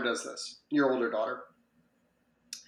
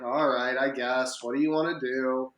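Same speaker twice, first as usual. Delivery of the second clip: a reluctant, grudging "All right, I guess" with lower pitch; begrudging; 3 semitones lower than usual. Retold or imitated. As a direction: sigh it out like you'd rather not play. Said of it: "begrudgingly going in and being like".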